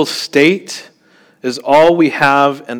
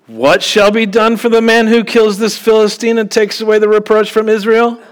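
high, loud, incredulous challenge; David's outraged, disbelieving question; indignant question; 9 semitones higher, 4 dB louder than usual. Acted. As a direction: fire the question up at the crowd. Preacher voicing David's indignant question.

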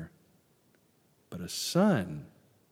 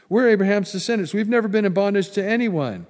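loud, fast, high indignant boast; 8 semitones higher, 9 dB louder, faster than usual. Retold or imitated. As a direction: throw the claim out as proud defiance.

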